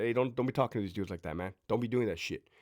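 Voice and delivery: hushed and quick, man to man; quiet private correction. Retold or imitated. The sergeant corrects the officer out of earshot, hushed and quick. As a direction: pull him aside and keep it low.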